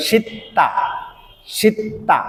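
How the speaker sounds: সিত্তা high, with a held stop before "ta"; repeat-after-me model. Shown as a demonstration. Teacher leading learners to repeat along, model pronunciation. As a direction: say সিত্তা as "shit-ta", pausing on the double t. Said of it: "আমার সাথে সাথে উচ্চারণ করবেন".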